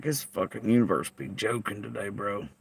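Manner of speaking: softly muttered to self; quiet retold remark. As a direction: murmur it to yourself. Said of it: "I was like".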